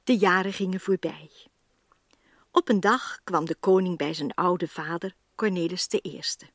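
ordinary talk, nothing marked

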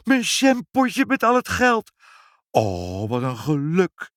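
high, overjoyed exclamation; thrilled at a lucky find; ecstatic shouting. A narrator's reading. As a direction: cry out with amazed delight. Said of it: "schreeuwde hij".

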